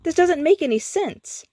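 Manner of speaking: very high, frantic protest; can't make sense of it; panicked. A narrator's reading. Skips panics at changed park.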